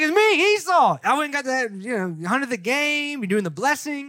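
incredulous Esau. Retold or imitated. Esau answering his confused father, incredulous. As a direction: insist on who you are, baffled.

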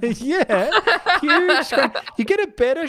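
high, loud, laughing agreement. Real, not acted. Agreeing with co-hosts about the huge screen, laughing.

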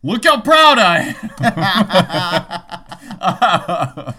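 high, booming mock boast; mock-proud shout. Teasing co-host about knowing the dad of five, mock-proud shout. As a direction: holler it slowly with hammed-up pride.

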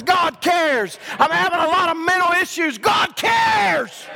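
high, loud, building sermon climax; preacher building to a roaring peak; shouting preaching. Real, not acted.

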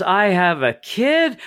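high, slow dawning line; stunned mock realization. Deadpool learning he has a daughter, mock shock. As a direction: drag it out, shocked. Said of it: "He goes".

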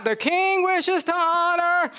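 high, slow, projected announcement. Retold or imitated. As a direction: call it out to the whole city. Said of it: "Louder, Haman, speak up".

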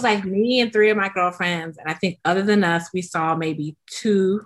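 ordinary talk, nothing marked

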